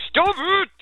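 high squeaky loud yell. Real, not acted. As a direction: shriek it at the horse. Scolding an annoying horse.

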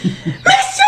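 high laughing mock yell; yelling like a madwoman. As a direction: scream for help, half laughing. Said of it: "gueuler comme une folle".

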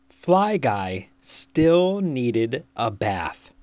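ordinary delivery

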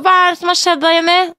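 lightly voiced grandma asking Jenny; imitating grandmother. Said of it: "sier mormor".

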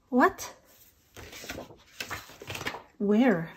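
sharp high what; startled by what she sees; surprised. Real, not acted. Samples suddenly missing.

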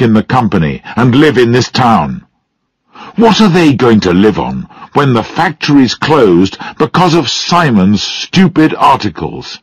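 high-pitched, indignant tirade; angry rebuke. A narrator's reading. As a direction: fire scornful questions at the daughter. Father angrily rebukes his daughter.

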